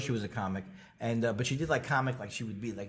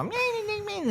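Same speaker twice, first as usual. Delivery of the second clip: high squeaky meh meh; mock Leno car noise; 3 dB quieter than usual. Mocking Jay Leno stuck with his broken car. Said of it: "to see Jay Leno go".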